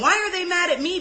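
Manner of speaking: voicing a wounded inner protest; indignant. Speaker retelling her thoughts when pissed, indignant.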